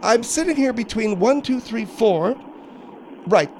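put-on high voice, indignant; exasperated Margaret. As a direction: play a fed-up woman counting heads. Margaret character in comedy bit.

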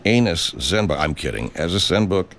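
usual speaking voice

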